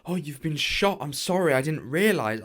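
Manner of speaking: shocked apology. Acted. Discovers the wound.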